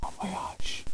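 unvoiced French whisper. Real, not acted. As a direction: whisper it back. Repeating French softly.

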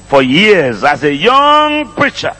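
ordinary talk, nothing marked

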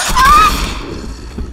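sudden high loud scream; startled scream. Real, not acted. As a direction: jolt out a real scream of fright. Monster lunges.

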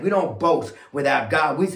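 normal talking voice

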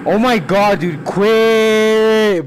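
normal talking voice

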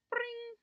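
high-pitched trilled doorbell ring; imitated doorbell. A narrator's reading. Narrator imitating the front doorbell as boy waits, high trill. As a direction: ring out like a bell, high and sustained. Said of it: "the doorbell went".

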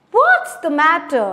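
loud, sharp question from the doorway; 1 alarmed call. Acted. Aunt Polly rushes in at the noise, calling loudly. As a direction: call out what's going on.

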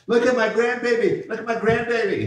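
excited grandmother. Retold or imitated. Patty showing the church her grandbaby, imitated high and excited. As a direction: show off the grandbaby with squealing joy. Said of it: "she was real excited".

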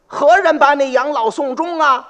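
the father asks who will care for him, pleading; old man pleading. Acted. Acted line, then retold plainly.

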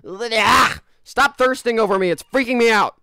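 loud, high mock outrage; yelling. Real, not acted. Streamer yelling at the game's characters in mock outrage. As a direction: shriek at the characters in fake outrage.

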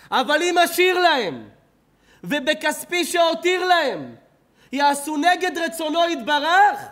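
loud, high and slow pleading; impassioned cry. Acted. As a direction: cry out the fear of punishment. Chafetz Chaim's dying explanation.

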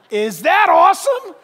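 loud laughing exclamation; delighted preacher laughing out loud; laughing shout. Real, not acted.

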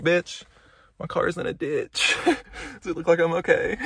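high, slow, laughing sass; sassy comeback. Retold or imitated. She answers a stranger asking if she's okay, high incredulous sass. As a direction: fire back incredulous, half laughing. Sassy retort to imagined passerby.

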